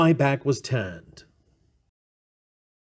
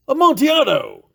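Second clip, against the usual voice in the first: eager exclamation; 8 semitones higher, 5 dB louder than usual. Acted. Fortunato hears of the wine.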